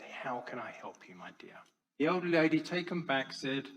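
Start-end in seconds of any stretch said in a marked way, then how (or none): 0.0-1.6 soft, calm and tender; gently disarm the angry stranger; a psychologist calmly answering an angry woman; calm and gentle. Retold or imitated. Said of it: "So she stayed calm".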